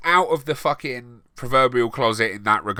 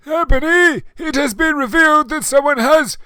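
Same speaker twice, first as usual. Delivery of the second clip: high, loud put-on old wizard; Dumbledore voice; 5 dB louder than usual. A narrator's reading. Dumbledore bursting in to tell Ebony news, high put-on voice. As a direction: announce it like a panicked headmaster.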